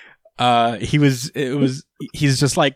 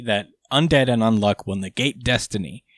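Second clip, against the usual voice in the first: ordinary delivery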